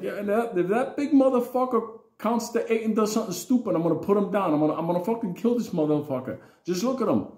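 high, loud menacing threat; a dad ready to fight; threatening father. Retold or imitated. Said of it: "he's like".

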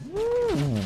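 high-pitched "woo" cheer; excited whoop. Real, not acted. Gamer celebrating a shot, whooping.

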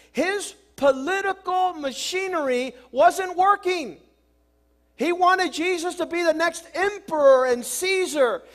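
loud, high-pitched pulpit preaching; fiery preaching. Acted. Preacher driving home Peter's rebuke, loud and fervent. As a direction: preach it hard to the congregation.

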